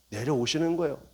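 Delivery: ordinary talk, nothing marked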